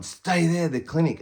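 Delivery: high, loud urgent yell; shouted order. Retold or imitated. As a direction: bark it across the yard like a guard. Squad yelling orders in the yard.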